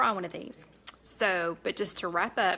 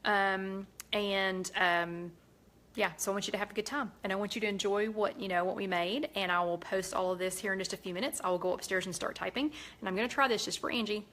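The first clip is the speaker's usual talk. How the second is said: ordinary talk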